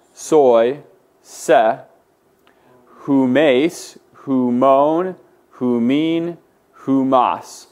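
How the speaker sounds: slow, measured paradigm chant, word by word; drawn-out forms with a pause after each; group recitation drill. Shown as a demonstration. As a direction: lead the class through each form. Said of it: "Let's go ahead and say them all together".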